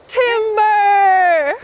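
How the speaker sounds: long high shouted timber; yelling. Pretend. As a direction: yell it out like a falling tree. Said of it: "You have to yell".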